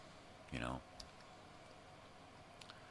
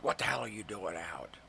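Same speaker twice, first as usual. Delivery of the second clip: higher, imitated scolding yell; mock yell; 5 semitones higher, 4 dB louder than usual. Owner opens the door on her dog, mock yell. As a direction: imitate an owner yelling at a pet. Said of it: "yells at the dog".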